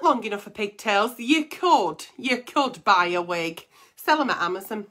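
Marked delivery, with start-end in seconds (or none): none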